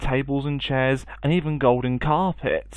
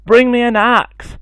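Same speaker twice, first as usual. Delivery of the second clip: loud, much higher urgent shout; 11 semitones higher, 9 dB louder than usual. Acted. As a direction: yell it to mother in panic. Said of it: "shouted Jack".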